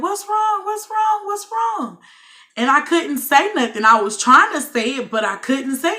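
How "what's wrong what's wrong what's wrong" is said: high-pitched, urgent repeated call; imitated alarm. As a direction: mimic a panicked crowd calling out. Imitates alarmed cellmates calling out.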